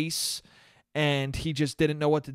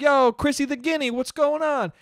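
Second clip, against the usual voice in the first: mock taunting heckler; 8 semitones higher than usual. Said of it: "imagine if he was like".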